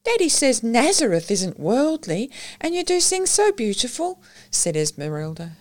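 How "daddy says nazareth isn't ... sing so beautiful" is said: put-on high child's voice, sulky and pleading; a little girl pouting as she asks; disappointed child. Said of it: "her mouth drooping".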